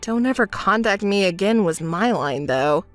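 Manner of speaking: low, unbothered quote; calm, wry aside; dry, deadpan remark. Acted. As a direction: repeat it wearily in a low voice. Drily repeats friend's parting words.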